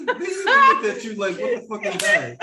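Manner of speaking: high pitched laughing retelling. Real, not acted. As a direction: laugh through the quote. Laughing while retelling reaction.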